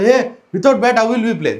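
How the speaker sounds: loud, high objection from a playmate; mock outraged call; acted protest. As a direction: protest like a boy losing his game. Acting out a friend's protest.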